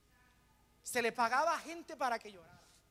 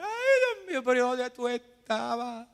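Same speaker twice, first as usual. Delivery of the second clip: mock crying lament; 2 semitones higher, 7 dB louder, slower than usual. Preacher mimicking a complaining believer, mock wailing. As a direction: imitate a believer whining to God. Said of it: "Y comienzas a llorar".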